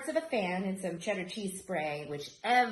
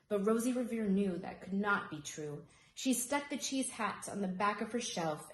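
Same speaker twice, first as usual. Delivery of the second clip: usual speaking voice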